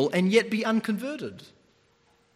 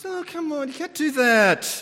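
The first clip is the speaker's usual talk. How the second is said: put-on whiny kid voice pitched way up; high-pitched whine; 9 semitones higher, 4 dB louder than usual. Kid protesting a broken promise, high whiny voice. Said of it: "it's that high pitch".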